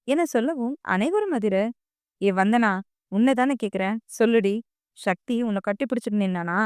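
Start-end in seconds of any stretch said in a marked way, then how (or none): none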